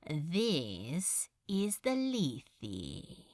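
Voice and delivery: lost in the swirling cup; dreamy. Acted. Beelzebub musing over the goblet, slow dreamy murmur. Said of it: "he said dreamily".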